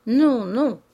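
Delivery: loud, swooping double refusal; loud protest. A narrator's reading. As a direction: cry out in refusal. Father protests to his daughters' embrace.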